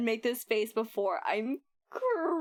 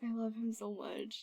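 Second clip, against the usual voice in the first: much softer, adoring; tender fondness; 9 dB quieter than usual. Real, not acted. Streamer cooing over her favourite character. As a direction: sigh it out lovingly. Fond remark after shouting.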